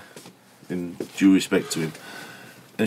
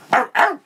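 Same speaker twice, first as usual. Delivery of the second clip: much higher, spitting "arr, arr" noise; mock disgusted grunt. Retelling the drunk woman's scorn, a high spitting grunt. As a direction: imitate her spitting in disgust. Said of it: "spat towards the floor and went".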